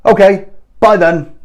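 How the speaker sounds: put-on chirp of retreat; mimicked meek caller. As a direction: imitate a caller giving up at once. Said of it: "what was your response".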